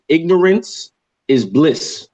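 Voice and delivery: ignorance is bliss said very slowly, every word weighed; slow emphasis. Shown as a demonstration. Host stating his key saying to viewers, slow and weighty. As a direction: lean on ignorance and draw the phrase out. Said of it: "let me, let me say that again".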